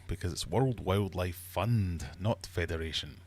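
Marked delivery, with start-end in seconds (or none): none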